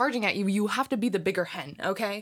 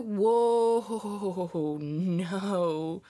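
long drawn-out whoa and no; exaggerated, stretched-out refusal; mock refusal. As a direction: draw it out, backing away from the drama. Acted refusal of family drama.

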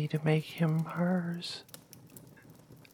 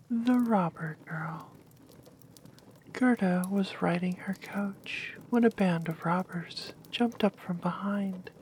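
whispered story. Acted.

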